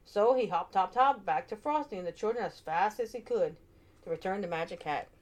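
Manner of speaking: ordinary delivery, nothing marked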